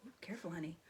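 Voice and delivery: hushed, gentle whisper; whispered aside. Real, not acted. Parent whispering to the child on her lap.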